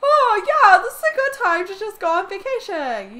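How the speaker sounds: loud high sing-song parent voice; hammed-up careless parent; mocking the parents. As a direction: mimic clueless parents, carefree. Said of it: "and they're just like".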